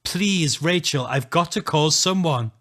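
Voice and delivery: high, loud desperate shout; shouting plea. Acted. Narrator pleads with Rachel down the phone, shouting. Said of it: "I'm shouting down the phone now".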